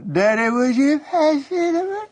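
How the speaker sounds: high-pitched mock whimper to Daddy; whining little girl. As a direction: whine it like a five-year-old. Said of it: "kind of whining".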